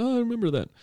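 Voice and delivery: high-pitched innocent act; pretending to casually recall; feigned innocence. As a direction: play dumb in a high, light voice. Pretending not to have messed up.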